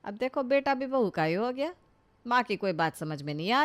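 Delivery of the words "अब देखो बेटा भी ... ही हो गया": usual speaking voice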